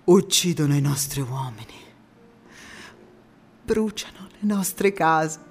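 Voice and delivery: from a hushed whisper to a loud, slow anguished cry; whisper building to outcry. Acted. Woman mourning the war's cruelties, building from whisper to a loud, slow cry. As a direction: start as a whisper and let the grief swell into a shout.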